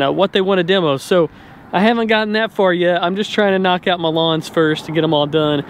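normal talking voice

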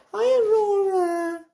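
very high, slow cooing hello. Real, not acted. Greeting ferret.

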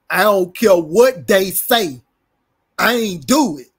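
loud, drawn-out mock denial; emphatic retold denial. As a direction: imitate a loud, insistent denial, stretching the words. Repeats retold denial with force.

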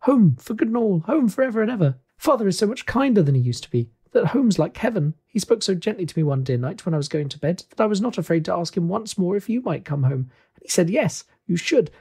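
put-on high, eager child voice; gleeful little girl. Little sister tells her brother about kinder father, gleeful. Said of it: "brimful of glee".

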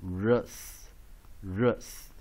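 "rus" as an isolated syllable with a short weak vowel. Shown as a demonstration. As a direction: model "rus" by itself, pausing after each. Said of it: "weak vowel U".

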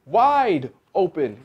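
loud, drawn-out command; yell. A narrator's reading. Dentist's wife ordering the fox, drawn-out yell. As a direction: stretch the first word in a big yell. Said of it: "yelled his wife".

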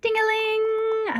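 high sung bell chime, slow; bell ding-a-ling. Acted. Narrator chiming the shop doorbell as it opens, high and slow.

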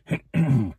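awkward high throat clearing. A narrator's reading. Thatcher clearing his throat to change the subject. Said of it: "Sin cleared his throat".

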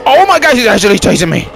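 high, loud alarmed cry; panicked. Real, not acted. Player realising the monster chases him, high alarmed cry. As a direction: blurt it out in real fright.

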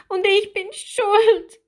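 very high, tearful confession; guilty little one crying, high-pitched; sobbing. A narrator's reading. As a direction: wail it in a small mermaid voice. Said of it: "schluchzte Lilli".

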